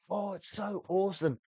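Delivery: high, mocking gush; high, sarcastic impression of gushing reviewers. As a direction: sneer at the reviewers in a high, silly voice. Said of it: "everyone's going".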